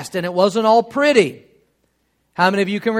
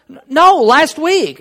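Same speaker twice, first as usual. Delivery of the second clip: put-on higher, louder protesting child; indignant kid; 8 semitones higher, 7 dB louder, slower than usual. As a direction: imitate a kid's emphatic correction. Imitates teased kid protesting.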